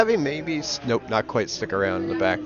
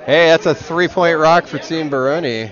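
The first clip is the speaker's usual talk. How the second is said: higher, louder sportscaster shout-out; excited call; 5 semitones higher, 6 dB louder than usual. Real, not acted. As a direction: cheer the three-point rock. Calling a three-point shot.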